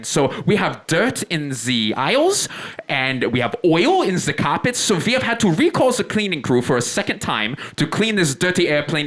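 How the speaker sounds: put-on high-pitched announcer with a foreign accent; airport loudspeaker announcer. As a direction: apologize over the PA in accented English. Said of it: "a voice comes over the loudspeaker".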